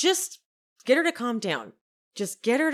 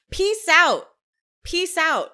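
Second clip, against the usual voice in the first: high, punched parody farewell; mock slangy sign-off; 8 semitones higher, 6 dB louder, slower than usual. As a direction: toss it out like a diva trying to be hip. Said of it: "The slang just".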